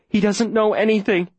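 high, hurried denial; urgent protest. Acted. Girl protecting Lee from the mayor, urgent protest. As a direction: jump in to shield a friend.

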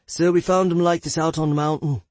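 a country local out of breath; rustic local voice. Acted. Local mountain man speaking.

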